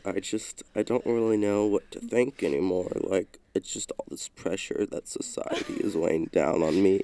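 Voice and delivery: low, downcast emo confession; emo alter ego. Acted. Acting out an alter emo for friends, low emo voice. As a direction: sigh it out low and weary like an emo kid. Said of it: "My alter emo is actually".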